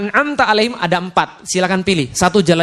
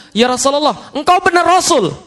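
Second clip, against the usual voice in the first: Umar's doubting plea; 8 semitones higher than usual. Acted. Umar doubting the Prophet.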